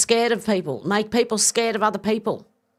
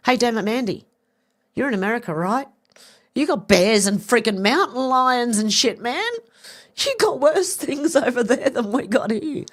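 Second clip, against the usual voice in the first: excited exclaiming; 6 semitones higher, 3 dB louder than usual. Real, not acted. Calls out to Mandy in chat, excited and louder. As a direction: rise up and push it out, amazed.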